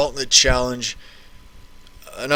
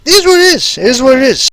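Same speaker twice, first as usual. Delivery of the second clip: sing-song catchphrase; 10 semitones higher, 5 dB louder, faster than usual. Acted. Catchphrase after the anecdote.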